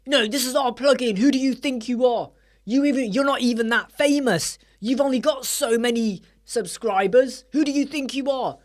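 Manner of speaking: imitating an arrogant developer scolding the vlogger; high and mighty. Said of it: "they get all high and mighty".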